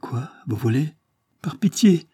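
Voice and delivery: a high, begging plea rising to a cry; pleading. A narrator's reading. Bigorne begs Sipierre not to read, pleading. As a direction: beg her not to read it. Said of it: "fit la Bigorne".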